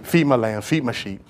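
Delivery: soft, low gentle question; hushed tender Jesus. Acted. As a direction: speak softly as Jesus restoring Peter. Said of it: "Jesus came back and said to Peter".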